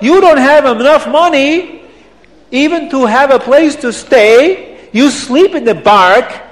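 put-on high, loud accented tirade; outraged, incredulous rant with swooping questions; angry Abdallah. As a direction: scold him in mounting indignation. Abdallah scolds the poor man angrily.

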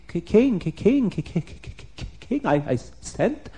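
stuttering on the first sound, fading to voiceless clicks; acted frightened stutter; trembling cook. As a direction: stammer in terror before the king. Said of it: "the cook is trembling and shaking".

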